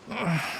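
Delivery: puzzled sigh. Acted. Guard baffled by the hero.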